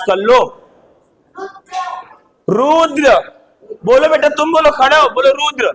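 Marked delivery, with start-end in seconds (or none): none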